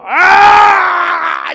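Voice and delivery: high, loud groan of loss; despairing cry. Acted. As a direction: wail like you just lost everything. Gambler losing at the casino.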